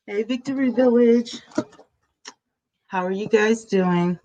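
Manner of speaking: distant, no microphone hooked up; speaking from across the room; far from mic. Real, not acted. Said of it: "if I sound a little distant".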